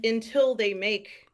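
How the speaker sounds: ordinary talk, nothing marked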